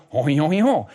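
cartoon French babble; mock French. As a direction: imitate French sounds as a foreigner hears them. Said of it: "So if it's French, no offense, we go".

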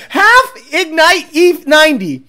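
loud, drawn-out proclaiming; delighted repeat. Real, not acted. Tuner repeating a viewer's joke, loud delighted proclaiming. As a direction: announce the joke slowly and loudly.

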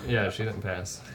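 usual speaking voice

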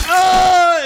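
loud, high mock scream; hammed-up shriek of pain. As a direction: scream as if the hands burst. Rory in pain, blood spraying.

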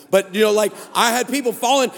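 usual speaking voice

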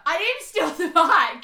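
high, loud, slow pleading denial; desperate protest. Retold or imitated. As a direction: insist hard, near tears. Insisting to police, upset.

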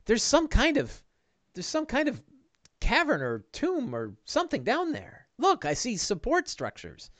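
high, swooping character voice; high-voiced curious helper; wondering sidekick Bing. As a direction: peer down and report in wonder, pitched high. Game master plays Bing.

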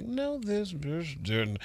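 very quiet, slow aside; hushed, drawn-out retold reaction; stunned mutter. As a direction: say it under the breath in disbelief. Stunned aside after being insulted.

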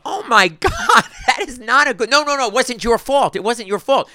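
high-pitched, laughing alarmed protest; mock horror. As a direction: react aghast, pleading through a laugh. Retells horrified reaction to crash.

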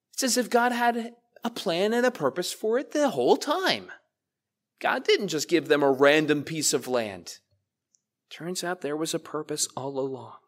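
ordinary delivery

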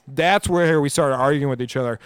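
ordinary talk

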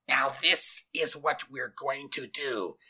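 slow, strangled and demanding; muffled, strangled menace; demanding scratchy voice. A narrator's reading. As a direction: squeeze it out slowly, scratchy and stern. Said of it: "in a demanding, scratchy voice that sounded like a strangled, muffled shriek".

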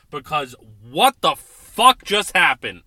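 loud, angry demand; real fury at the team; angry outburst. Real, not acted. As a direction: blow up at what just happened. Furious rant about the game.

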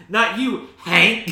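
loud, high mock-indignant cry; mock outrage. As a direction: shout the name in hammed-up disgust. Mock outrage at the absent dad.

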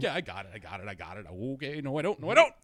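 confident then rising to a high loud panic; half-laughing mock panic; panicky self-talk. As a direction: reassure yourself, then lose it. Retelling losing control on skateboard.

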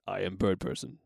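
low, deadpan bird-man voice; mock-solemn low impression; Bird Person. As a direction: speak in a deep, measured monotone. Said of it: "does he talk like this?".

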